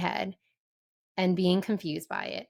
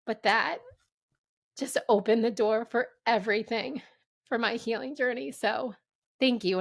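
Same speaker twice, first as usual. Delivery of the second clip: getting emotional; 4 semitones higher than usual. Real, not acted. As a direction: voice going higher as tears come. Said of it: "I'm gonna get emotional here".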